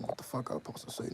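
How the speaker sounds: ordinary delivery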